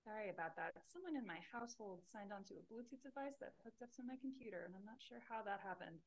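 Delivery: very faint, far from the mic; barely audible. Real, not acted. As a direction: speak faintly from a distance. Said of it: "I can barely hear you".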